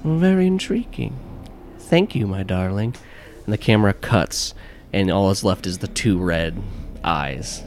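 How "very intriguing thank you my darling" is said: wicked madame. Acted. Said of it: "she says".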